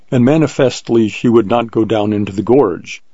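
ordinary delivery, nothing marked

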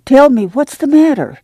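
high, pressing demand; a mother presses her daughter for the truth. A narrator's reading. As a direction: push the child for an answer. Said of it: "she demanded".

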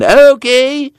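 high mocking shout; mock kids' yell. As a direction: yell it like jeering schoolkids. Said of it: "them a yelling".

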